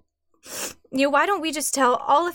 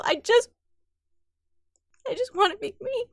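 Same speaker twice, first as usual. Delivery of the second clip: crying, higher and pleading; 6 semitones higher than usual. Acted. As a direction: voice breaking into tears. Emily breaking down over news.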